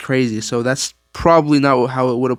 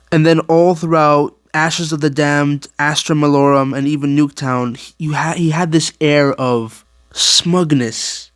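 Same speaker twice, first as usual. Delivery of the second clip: normal talking voice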